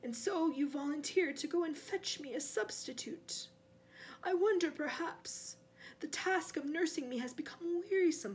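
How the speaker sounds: silky, suspicious villain; cold master. Acted. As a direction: probe the servant's loyalty coolly. Cold master voices his suspicion.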